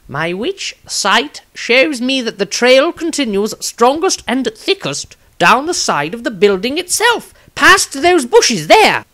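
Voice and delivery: high, slow, earnest wizard tracking a trail; a fussy wizard reporting what his magic shows; wizard's voice. Acted. Wizard Maestro telling the group where the trail goes, high and slow.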